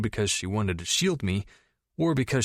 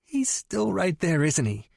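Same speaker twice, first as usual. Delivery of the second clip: a sheepish little squeak; squeaky realization; 8 semitones higher than usual. Acted. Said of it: "Tori squeaked".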